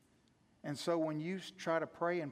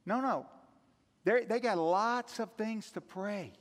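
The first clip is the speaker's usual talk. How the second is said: fiery preaching; 3 semitones higher, 7 dB louder, slower than usual. Acted. Pastor stirring the congregation about pagan prayer, loud and fervent. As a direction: preach it hard to the room.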